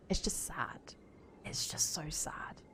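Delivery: breathed, near-whispered dismay; real sadness. Real, not acted. Streamer reflecting on women shamed about their bodies, sad near-whisper. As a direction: let the voice sink into a slow whisper.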